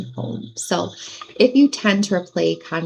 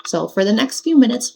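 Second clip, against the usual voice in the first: ordinary talk, nothing marked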